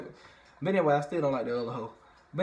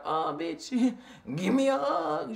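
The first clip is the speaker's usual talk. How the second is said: high, laughing, slowed-down plea for a hug; Brandy laughing at her cold friend; laughing demand; 9 semitones higher, slower than usual. Retold or imitated. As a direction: laugh it out, coaxing. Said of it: "Brandy was looking at her like".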